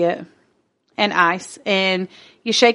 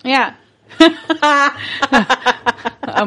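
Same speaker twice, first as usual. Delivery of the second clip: usual speaking voice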